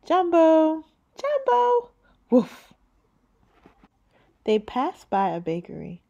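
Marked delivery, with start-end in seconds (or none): 0.0-0.8 high, loud cheerful greeting. Acted. Joseph's parents greeting the girl, loud and high. 1.2-1.9 put-on high, bright child's greeting. Girl answering the restaurant owner, high child voice. 2.3-2.7 a single playful bark; dog bark. Acted. The dog Manny greeting, playful bark.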